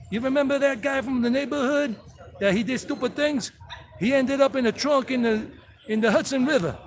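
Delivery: high-pitched mock Brooklyn wise-guy accent. Vlogger voices Brooklyn pigeons hollering at each other, mock Brooklyn accent. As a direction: call to your pals like a hammed-up Brooklyn mobster. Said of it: "These pigeons are for Brooklyn".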